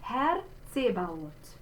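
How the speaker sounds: "Herr Zebaoth" slow, with open e and ts for Z; German diction. Shown as a demonstration. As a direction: say "Herr Zebaoth" slowly, word by word. Teacher models next German phrase.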